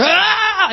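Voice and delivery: high, sudden cry of fright; startled yelp. Retold or imitated. Man in a pit facing a snake, high startled yelp. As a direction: yelp as if a snake just appeared. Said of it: "He went".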